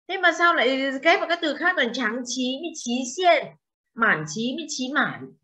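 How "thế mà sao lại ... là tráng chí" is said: ordinary delivery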